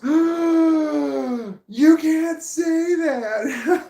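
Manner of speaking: gasping, very high and loud drawn-out shout; wife horrified at what he just yelled; shocked wife. Retold or imitated.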